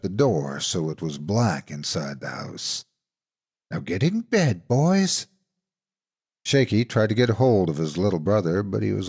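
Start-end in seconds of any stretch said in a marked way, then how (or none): 3.7-5.3 fake auntie order. A narrator's reading. Monster orders boys.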